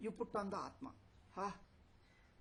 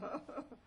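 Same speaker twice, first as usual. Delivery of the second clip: short high laugh; amused chuckle; 8 semitones higher than usual. Real, not acted. Teacher joking that they'll be angry, amused chuckle. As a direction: chuckle at your own cheeky remark.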